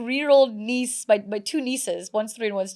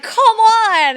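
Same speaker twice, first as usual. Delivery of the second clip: exasperated whine; 13 semitones higher, 10 dB louder than usual. Retold or imitated. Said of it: "And I'm like".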